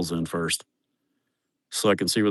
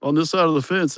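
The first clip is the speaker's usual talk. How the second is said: higher, lightly voiced Mexican accent; light imitation of the worker's Mexican accent; retelling the worker's worry in a Mexican accent; 8 semitones higher than usual. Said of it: "He goes".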